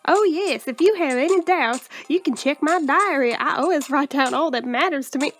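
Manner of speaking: put-on hammy drawling accent with swooping pitch. Game heroine showing her cards and diary, thick drawling accent. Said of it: "I should do it with an accent".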